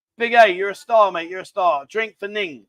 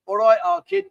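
high, loud mock Scouse; Liverpool accent; 9 semitones higher, 5 dB louder than usual. Shown as a demonstration. Host coaching someone to copy Liverpool speech, high Scouse accent. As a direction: model the Scouse accent, pitched up and louder. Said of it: "Right, you gotta say".

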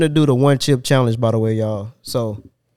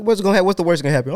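ordinary talk

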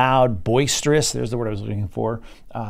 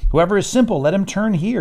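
high, louder call to passersby; raised, high-pitched beckoning voice; calling out as Folly; 8 semitones higher, 4 dB louder than usual. Acted. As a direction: call out like Folly luring in the simple. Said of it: "catch the yelling out of, of folly here".

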